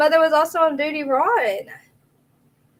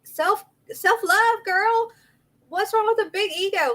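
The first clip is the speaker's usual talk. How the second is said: high-pitched retelling of one's own comeback; sassy retort; 9 semitones higher than usual. Retold or imitated. Streamer retelling her reply about ego, sassy retort. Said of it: "And I was like".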